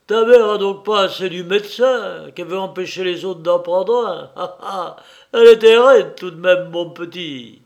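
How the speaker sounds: breathless triumphant shout; triumphant cry. A narrator's reading. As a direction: crow over your victory. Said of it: "d'une voix haletante".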